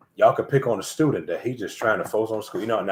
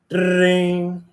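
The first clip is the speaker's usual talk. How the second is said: imitated ding; 10 semitones higher, 5 dB louder than usual. Imitated sound of door or phone.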